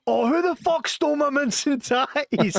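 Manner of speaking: high, slow, drawn-out furious yell; outraged yell. Retold or imitated. Colleague yelling across the call centre for his stolen lunch, high slow outrage. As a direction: bellow it across the office. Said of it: "all you heard through the call centre was".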